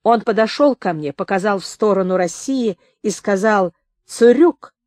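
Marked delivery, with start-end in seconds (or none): 4.1-4.7 loud, raised one-word order; soldier's command. A narrator's reading. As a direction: order them back firmly. German soldier orders them back.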